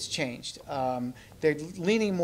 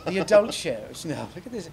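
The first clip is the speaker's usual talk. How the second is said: lightly British puppet voice; British accent. Acted. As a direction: keep the Cambridge character going.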